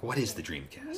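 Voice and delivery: mock curious question; wondering aloud at a mystery; puzzled wonder. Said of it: "where it was like".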